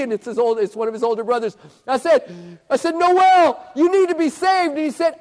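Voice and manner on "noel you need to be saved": high, loud exhortation; urgent appeal. Retold or imitated. Preacher urges Noel to be saved, loud and urgent. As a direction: press him hard at full voice.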